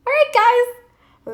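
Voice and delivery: high, loud, slow all right guys; big stretched cheer to viewers; excited sign-off. Real, not acted. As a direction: burst into the outro. Sign-off exclamation.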